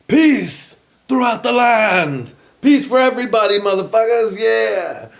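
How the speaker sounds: high, loud herald's shout, drawn out at first; triumphant mock cheer at full voice; mock peace proclamation. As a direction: proclaim it to the whole land, hammed up. Acting crowd proclaiming peace.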